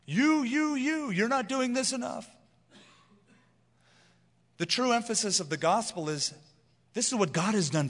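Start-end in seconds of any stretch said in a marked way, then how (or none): 0.1-2.3 high, hammering accusatory imitation; mock guilt-tripping preacher. Preacher mimicking guilt-heaping pulpits, high mock accusation. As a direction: pile on mock blame at a high pitch. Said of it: "Heaping guilt upon the congregation".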